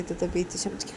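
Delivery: ordinary delivery, nothing marked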